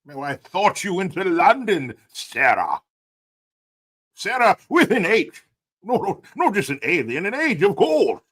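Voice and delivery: high, hammed-up British toff; posh British. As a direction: fuss over it in a mock posh British accent. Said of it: "I like your British accent".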